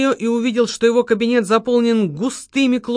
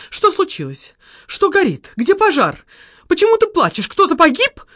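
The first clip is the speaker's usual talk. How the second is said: встревоженно; 9 semitones higher than usual. A narrator's reading. Встревоженная подруга расспрашивает.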